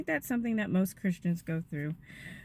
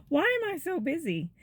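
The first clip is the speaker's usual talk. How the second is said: exasperated question; 8 semitones higher than usual. Retold or imitated. Repeated with exasperation.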